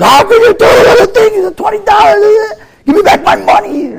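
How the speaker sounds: high, loud whining protest, nearly crying; mock wail about being overcharged; whiny complaint.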